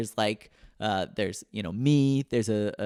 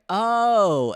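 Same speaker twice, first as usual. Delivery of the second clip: sudden realization; 10 semitones higher, 6 dB louder than usual. Retold or imitated. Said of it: "And then they're like".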